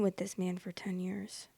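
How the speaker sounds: whispered under the breath, stunned; whispered disbelief. Real, not acted. Repeating the question to herself, stunned whisper.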